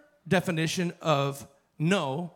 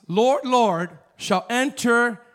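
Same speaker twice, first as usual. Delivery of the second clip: "Lord, Lord" drawn out slow and loud; dramatic scripture reading; stressed Lord Lord; 5 semitones higher, 8 dB louder, much slower than usual. Acted. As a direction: lean hard and slow on "Lord, Lord". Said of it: "now listen to this".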